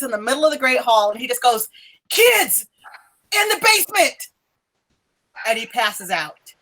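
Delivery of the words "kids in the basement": high-pitched, urgent warning in two bursts; out of breath. Acted. Professor collapsing in the Great Hall warns everyone, breathless alarm. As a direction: gasp out an alarm, broken by a pause. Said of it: "he's out of breath".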